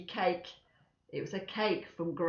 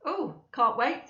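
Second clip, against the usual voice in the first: mild sarcasm; 5 semitones higher, slower than usual. Acted. Father sarcastic.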